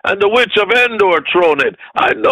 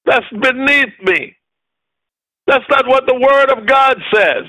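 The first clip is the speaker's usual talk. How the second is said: high, indignant preacher's outburst; angry defiance; 8 semitones higher than usual. Acted. Preacher showing the church how to refuse their condition, angry. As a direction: fire off each refusal in a raised pitch. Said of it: "You're to be angry at your condition".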